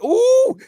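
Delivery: a high, loud pained ooh; wincing ooh. Real, not acted. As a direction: cry out as the punches land. Fighter taking savage beating.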